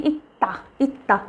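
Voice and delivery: इक्ता with the k closing the first syllable; k sound kept. Shown as a demonstration. Teacher showing how the verb is said, इक्ता with its k sounded. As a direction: say इक्ता softly, k before the t. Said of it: "इसको हम क्या बोलते हैं".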